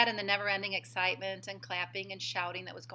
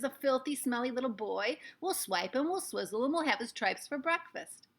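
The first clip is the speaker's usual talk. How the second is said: higher, gloating cackling threats; disgusted witch crowd; 5 semitones higher than usual. A narrator's reading. Witches threatening the boy, gloating and high. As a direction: relish the threat.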